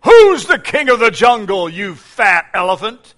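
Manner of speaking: loud, high, taunting challenge; swaggering lion. Acted. Lion insulting the fat elephant, loud and high. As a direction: sneer it at the big elephant. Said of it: "And the lion says".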